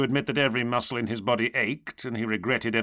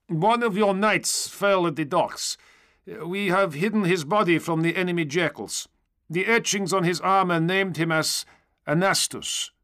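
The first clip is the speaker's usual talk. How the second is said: high, earnest trooper voice; stormtrooper bearing news; 9 semitones higher than usual. A narrator's reading. Stormtrooper reporting a fallen knight, high earnest voice. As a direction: give the report high and steady.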